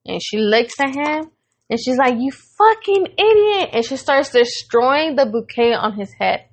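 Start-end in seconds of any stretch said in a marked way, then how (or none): 2.2-3.7 high, slow, drawn-out mock outrage; retold angry yelling. Girlfriend to man after reading the banner, imitated angry yelling. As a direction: imitate her cussing him out, pitched way up. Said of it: "she's yelling at him and cussing at him".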